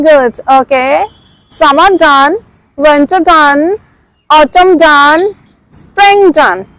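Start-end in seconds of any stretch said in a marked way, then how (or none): none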